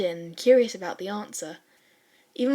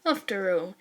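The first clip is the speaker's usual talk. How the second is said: ordinary delivery, nothing marked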